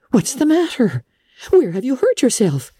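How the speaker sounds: high, urgent questions; demanding Missus Barry. A narrator's reading. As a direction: insist on an answer. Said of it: "demanded Missus Barry".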